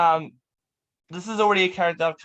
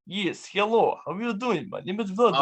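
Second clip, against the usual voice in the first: Russian brother; 3 semitones higher than usual. Acted. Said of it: "adopted brother".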